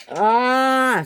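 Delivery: high, loud "ah"; startled cry. Acted. Henry cries out mid-story.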